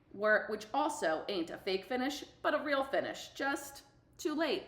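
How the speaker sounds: plain reading voice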